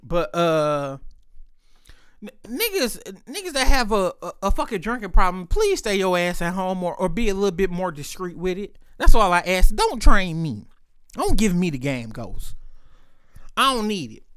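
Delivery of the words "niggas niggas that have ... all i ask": normal talking voice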